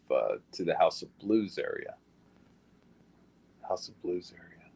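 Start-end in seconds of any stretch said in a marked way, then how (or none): 3.6-4.7 whispered to himself, no voice. Real, not acted. Repeating the place name to himself in a whisper. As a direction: breathe the place name under his breath.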